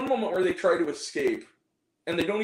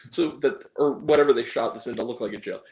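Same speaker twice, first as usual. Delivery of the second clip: normal talking voice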